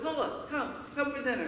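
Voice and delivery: mother calling. Retold or imitated. Mother calling child inside.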